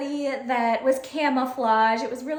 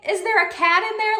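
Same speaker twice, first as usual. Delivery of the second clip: high, loud, fast cat in there; startled question; 9 semitones higher, 5 dB louder, faster than usual. Retold or imitated. Teacher startled by student talking to her sleeve. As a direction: blurt it out.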